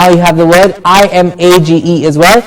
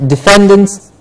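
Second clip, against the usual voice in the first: usual speaking voice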